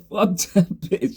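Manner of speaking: slow, laughing through it; laughing dismissal. Retold or imitated. Answering his boy about the girl, with slow laughter. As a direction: brush her off while cracking up. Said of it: "I was like".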